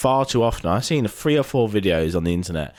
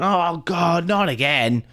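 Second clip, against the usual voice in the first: higher-pitched 'here we go' complaint; mock exasperation; 8 semitones higher, slower than usual. Lorry driver throwing his hands up, acted exasperation. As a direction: moan it like a recurring nuisance. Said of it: "throwing his hands up in the air".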